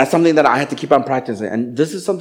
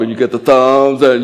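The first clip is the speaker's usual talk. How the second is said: usual speaking voice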